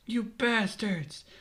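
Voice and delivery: high, drawn-out cry of outrage; acted angry yell, slow and high; outraged yell. A narrator's reading. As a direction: stretch the insult out in a high voice. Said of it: "Luffy yells under the bandit's boot".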